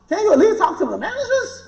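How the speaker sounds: high, laughing request to God; half-joking plea to God, laughing. Retold or imitated. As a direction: beg God through a laugh.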